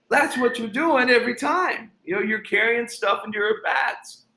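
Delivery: high SoCal coach voice, explaining; imitating Ken Ravizza. Coach explaining the lesson to his player, high SoCal voice. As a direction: drive the lesson home in a high mellow voice. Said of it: "really high, SoCal voice".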